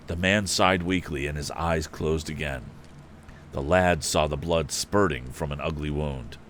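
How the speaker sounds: ordinary delivery, nothing marked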